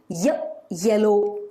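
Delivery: "Y" said as a short "yuh", then "yellow"; consonant Y sound. Shown as a demonstration. Teacher showing the sound Y makes, phonics demo. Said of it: "the letter Y is making the Y sound".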